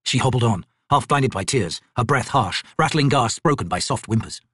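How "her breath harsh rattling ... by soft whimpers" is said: plain reading voice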